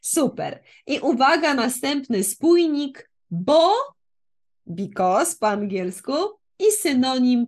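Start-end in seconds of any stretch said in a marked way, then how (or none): none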